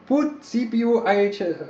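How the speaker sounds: high-pitched put-on Russian accent. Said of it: "I can do a Russian accent".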